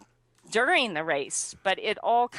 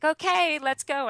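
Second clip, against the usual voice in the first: mom's brisk high call; a higher, brisk let's go; mother eager to leave the race, brisk high call; 8 semitones higher than usual. Retold or imitated. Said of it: "she's like".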